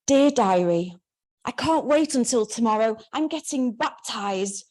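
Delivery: put-on a devout girl gushing about her baptism; earnest teen diary voice. The teenage narrator reads her diary, earnest and eager.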